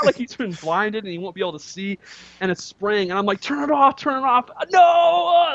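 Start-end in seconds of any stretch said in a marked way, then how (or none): 3.4-5.5 panicked. Retold or imitated. Panicked shouting at spraying bidet.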